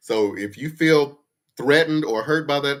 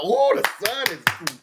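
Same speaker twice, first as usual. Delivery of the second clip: gullible agreeing; 8 semitones higher, slower than usual. Retold or imitated. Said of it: "and y'all".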